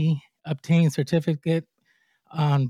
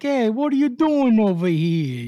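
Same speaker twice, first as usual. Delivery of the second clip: mock Boston accent, pitched higher; 6 semitones higher, 4 dB louder than usual. Host teasing guest about his city's accent, mock Boston accent. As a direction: toss off a jokey Boston accent impression. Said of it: "you don't get that accent like".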